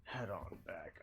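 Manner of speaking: soft whispered aside; whispering. Real, not acted. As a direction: whisper it to yourself.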